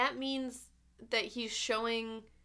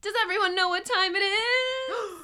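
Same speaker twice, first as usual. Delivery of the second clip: high, loud game-show hype; hype announcer; 10 semitones higher, 9 dB louder than usual. Acted. Announcing dad joke segment.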